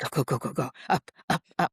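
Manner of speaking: lightly voiced urging collie; rapid little muttered repeats, imitating a dog; muttering dog. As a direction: nag like an impatient dog. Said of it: "muttering to herself".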